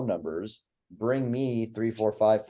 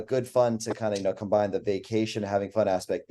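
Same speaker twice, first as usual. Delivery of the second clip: usual speaking voice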